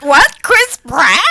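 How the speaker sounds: high, loud mock shock; incredulous. Acting out a fan hearing the casting, incredulous. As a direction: question it in loud disbelief.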